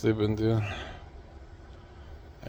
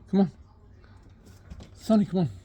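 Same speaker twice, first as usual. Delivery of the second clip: calling the cat; 9 semitones higher, faster than usual. Real, not acted.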